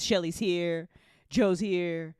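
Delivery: normal talking voice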